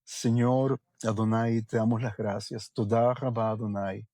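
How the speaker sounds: low, soft prayer voice; hushed prayer. Acted. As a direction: pray quietly, inviting all to close their eyes. Leads a prayer.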